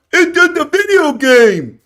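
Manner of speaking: slow, sneering high mock quote; mock dismissive. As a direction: mimic someone brushing it off. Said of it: "do you have three hundred million dollars to".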